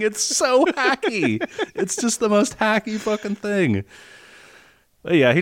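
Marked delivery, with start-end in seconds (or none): 0.0-3.8 pitched way up, mocking; high scornful disbelief. Real, not acted. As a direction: sneer at how cheap it is. Mocking film's cliché.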